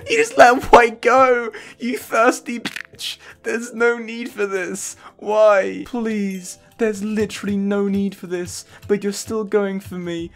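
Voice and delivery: high, slow, nasal-free put-on whine; mock whiny pleading. Gamer whines at Green for hunting him, mock pleading.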